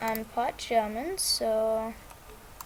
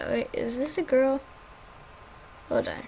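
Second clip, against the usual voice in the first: usual speaking voice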